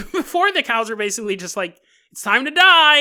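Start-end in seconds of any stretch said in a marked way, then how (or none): 2.1-3.0 hammed-up killer's cry, loud and high; mock menacing shout. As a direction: yell it like a cartoon slasher. Mock threat of a slaughterhouse killer.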